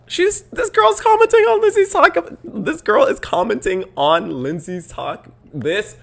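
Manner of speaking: high-pitched exasperated squeal; loud, worked-up annoyance; so annoyed. Real, not acted. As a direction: screech it, tripping over the words in irritation. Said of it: "I'm getting so annoyed".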